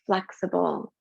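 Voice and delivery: slow, calm breathing guidance; guided meditation. Acted. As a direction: soothe them through each breath.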